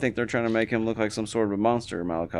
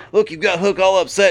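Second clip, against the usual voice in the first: high, loud mock Taz voice; imitating Taz; 11 semitones higher, 8 dB louder than usual. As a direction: ham up a gruff boss talking up his kid. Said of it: "He's like".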